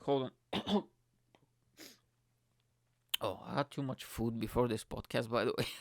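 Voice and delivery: ordinary talk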